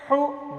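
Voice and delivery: ہو with the h made in the throat; throaty h. Shown as a demonstration. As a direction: push the h of ہو out from the throat. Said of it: "آخری حلق سے نکالتے ہیں". (ہو کی ہا حلق سے)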